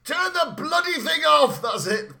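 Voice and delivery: hammed-up urgent shouting; imitated Dalton outburst. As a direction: act out a desperate film-hero yell. Imitates Dalton's yelled line.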